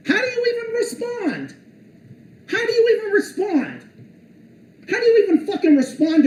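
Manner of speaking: high incredulous cry; exasperated disbelief. Real, not acted. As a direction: throw up your hands in disbelief. Exasperated repeated question.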